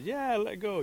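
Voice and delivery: high, loud go-ahead; eager Rabbit. A narrator's reading. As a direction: urge your helper on without hesitation. Rabbit urges Bear to drop rock.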